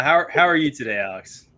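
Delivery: ordinary talk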